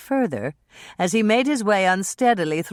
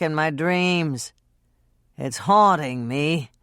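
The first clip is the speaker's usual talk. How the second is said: slurring. Acted. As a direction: drag the words like a drunk. Drunk Dean confessing.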